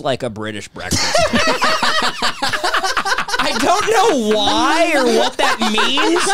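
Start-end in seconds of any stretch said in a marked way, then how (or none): 1.3-6.2 laughing. Real, not acted. Cracking up at own joke.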